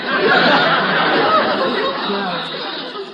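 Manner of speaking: high cackling laugh at his own joke. Acted.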